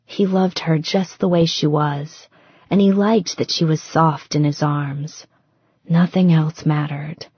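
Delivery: plain reading voice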